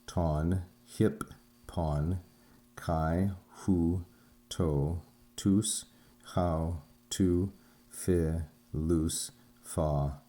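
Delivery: slow, measured reading with a pause after each syllable; deliberate, evenly spaced syllables, like a teacher dividing words. Shown as a demonstration. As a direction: sound out each Greek syllable separately. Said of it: "When we syllabify, we place a vertical line between syllables".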